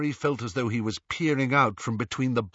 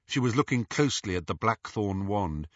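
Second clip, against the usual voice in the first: plain reading voice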